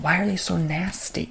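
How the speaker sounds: normal talking voice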